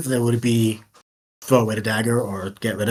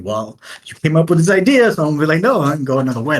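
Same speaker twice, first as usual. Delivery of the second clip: louder, higher mock voice of a stubborn jerk; contrary jerk; 5 semitones higher, 5 dB louder, faster than usual. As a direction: lightly act out someone being difficult. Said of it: "be that dick who's like".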